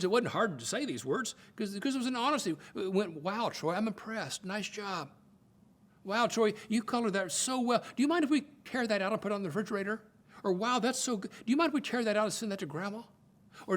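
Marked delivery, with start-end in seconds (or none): 3.2-10.0 higher-pitched proud dad gushing; impressed praise. Retold or imitated. Dad praising his son's coloring, impressed and upbeat. 10.5-13.0 high-pitched, quick, eager dad praise; impressed praise. Retold or imitated. Dad asks to send it to Grandma, impressed and eager.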